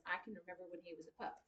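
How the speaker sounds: fast, light run through the line; quick reading. Shown as a demonstration. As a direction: rattle it off like a lively pup. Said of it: "Young pup moves quickly".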